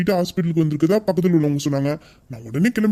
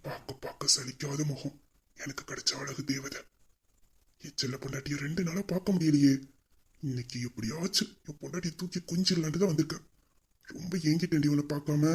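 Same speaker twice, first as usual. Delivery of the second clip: murmured to himself; 10 dB quieter than usual. Acted. Husband murmurs about his wife.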